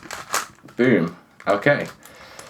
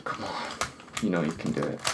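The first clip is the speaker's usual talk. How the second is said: much softer, gentle encouragement; soft coaxing; 3 semitones lower, 12 dB quieter, faster than usual. Real, not acted. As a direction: murmur it to the puzzle. Softly coaxing the puzzle.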